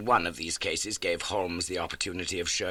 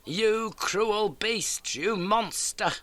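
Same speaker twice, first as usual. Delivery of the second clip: retold anguished cry; 14 semitones higher, 3 dB louder, much slower than usual. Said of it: "she cried".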